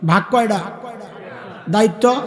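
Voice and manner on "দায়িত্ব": ordinary talk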